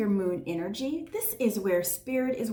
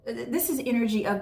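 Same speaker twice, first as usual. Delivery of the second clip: normal talking voice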